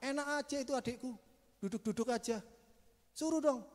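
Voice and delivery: a touch of Martha's irritation; half-hearted peeved complaint; mild mock anger. Martha complaining to Jesus, lightly annoyed. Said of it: "marah gini".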